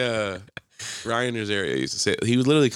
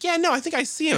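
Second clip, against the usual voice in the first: much higher falsely agreeable tone; fake agreeable voice. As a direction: play along too sweetly. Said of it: "I'm like".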